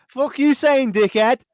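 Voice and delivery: put-on high, slow Australian accent jibe; Australian accent, teasing his mate; mock Australian accent. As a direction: drawl an Australian accent insult. Accent banter continues.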